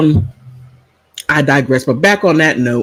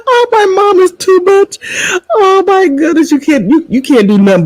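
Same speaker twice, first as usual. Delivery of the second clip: very high, squealed words through laughter; laughing till crying. Real, not acted. Daughter delighted by outrageous mom, squealing with laughter.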